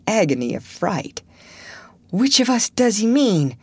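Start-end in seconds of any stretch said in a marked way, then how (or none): 2.1-3.6 high, breath-caught question; frightened gasp. A narrator's reading. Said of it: "gasped Huckleberry".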